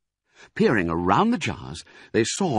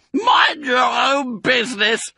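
high, slow, loud rat screech; rat shriek; 6 dB louder, slower than usual. Acted. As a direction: shriek it like a furious little rat. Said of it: "shrieked Rat".

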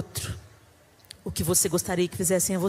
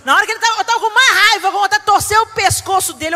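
much louder, higher mock rage; mock fury; 10 semitones higher, 11 dB louder, faster than usual. Mother recalling wanting to wring his neck, mock fury. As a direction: blow up with playful anger.

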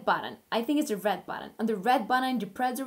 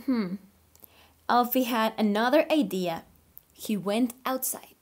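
put-on reading to children; 3 dB louder than usual. Teacher reading a story to her online class, animated. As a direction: read aloud like a picture book for little kids. Reads picture book aloud.

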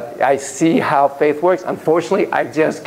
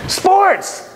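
excited announcement. Acted. Lecturer to audience, launching the sports topic, excited. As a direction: burst out with the word like a fan.

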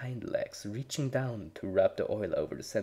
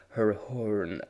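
plain reading voice

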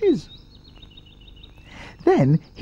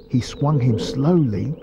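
usual speaking voice

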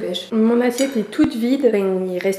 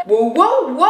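loud wows; 4 semitones higher, 8 dB louder, slower than usual. Real, not acted. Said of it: "j'adore crier".

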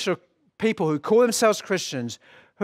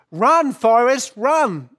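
loud, higher-pitched call with drawn-out words; Jenny's urgent call; 6 semitones higher, 6 dB louder, slower than usual. Retold or imitated. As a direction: call it out like a famous film line. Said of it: "Jenny, his friend, says those famous words".

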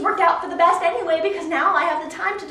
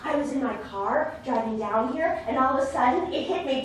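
ordinary talk, nothing marked

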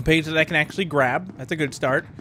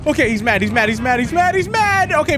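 panicked; 7 semitones higher, 4 dB louder than usual. Real, not acted. Fleeing angry monster.

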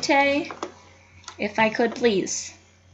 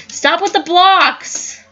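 high, slow, louder exasperated cry; frustrated shout; 8 semitones higher, 5 dB louder, much slower than usual. Real, not acted. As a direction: drag it out at the lagging game. Frustrated at lagging game blocks.

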